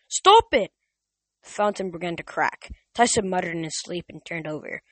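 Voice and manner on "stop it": much higher, louder panicked shout; loud, high, frantic yell. A narrator's reading. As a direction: yell to stop the vision. Percy yelling at fountain.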